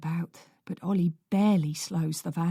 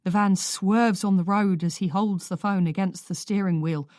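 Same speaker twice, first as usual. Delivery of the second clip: ordinary delivery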